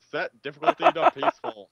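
pirate voice. Pretend. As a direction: shout it like a pirate captain. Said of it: "Pirate voice".

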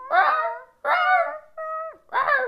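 very high, short little roars; baby tiger cub. Pretend. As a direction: roar like a tiny cub. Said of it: "which is a baby, might say".

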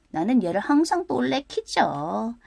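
plain reading voice

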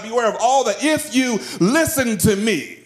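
high, urgent pulpit warning; impassioned preaching. Real, not acted. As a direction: sound the alarm to the congregation, pitch held high. Preaches warnings at full force.

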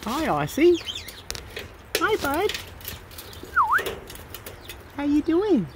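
high, sing-out hello to a pet; pet-greeting voice. Real, not acted. Owner saying hi to pets, high pet-greeting voice. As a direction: greet the animals high up in your voice.

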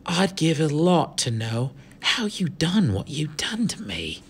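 slow, whispery thug voice; rough British street accent, hushed and slow; muttered near-whisper. Acted. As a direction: let it sink to a whisper, intent on knowing. Gang leader muttering to Omri.

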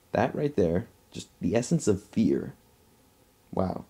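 ordinary delivery

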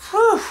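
high, loud breathy whew; worn-out exhale; tired whew. Real, not acted. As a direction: blow it out after exertion. Exhausted after counting.

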